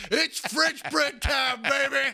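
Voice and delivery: stretched-out party yell; mock victory shout. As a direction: bellow it out slow like a triumphant fool. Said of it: "arms over your head like".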